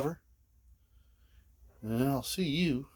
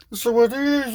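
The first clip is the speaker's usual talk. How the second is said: high silly voice; 13 semitones higher, 6 dB louder than usual. Pretend. Third repeat, high put-on voice.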